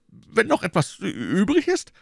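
high-pitched anxious stammer. A narrator's reading. Innkeeper echoes Athos in dread, stammering. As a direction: trip over the word, rattled and high. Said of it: "stammelte er".

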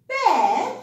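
"Bed" said loud and high, clearly modelled; modelling a word. Shown as a demonstration. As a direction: sound out "Bed" for a child.

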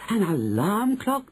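high, drawn-out question; astonished cry. A narrator's reading. Witches echoing their leader's reveal, astonished cry. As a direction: echo it high in amazement. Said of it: "they cried".

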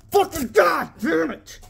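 yelled high and drawn out in frustration; angry shout. Real, not acted. Vlogger yelling at a bad pack pull, furious shout. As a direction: bellow the curse at a bad pull.

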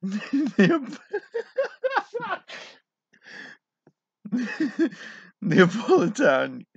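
breaking into high laughter mid-word; can't get the word out for laughing. Real, not acted. Reading a joke to viewers, cracking up.

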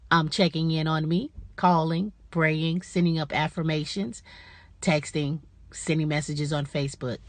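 normal talking voice

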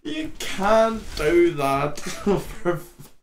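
high, breathless laughing through the words; helpless laughter. Real, not acted. Laughing at the mashed potato back, squeaky and breathless. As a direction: crack up in disbelief. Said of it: "I have a little tear there".

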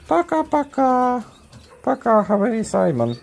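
sing-song squeak to the dog. Real, not acted. Dog says bye.

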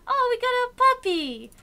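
high happy yelp for the puppy; excited squeal. Real, not acted. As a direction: burst out with joy at a new puppy. Sudden delight at game event.

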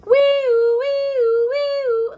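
high, loud wee-oo siren; siren wail. Acted. Fire engine siren imitated.

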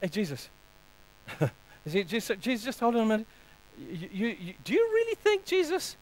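hesitant, incredulous needling; Satan. Acted. Satan taunts Jesus in the garden, mockingly. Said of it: "the words of shame from Satan saying".

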